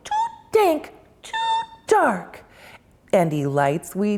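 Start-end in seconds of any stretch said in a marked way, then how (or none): 0.0-2.4 high, fast tiny mouse squeak; a small scared mouse piping up; lightly voiced little mouse; squeaky mouse. A narrator's reading. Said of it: "Mouse squeaks".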